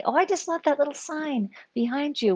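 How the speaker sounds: high, bright-eyed delight over the sign; gushing compliment. Retold or imitated. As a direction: gush over it like a delighted friend. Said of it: "would pay attention to and say".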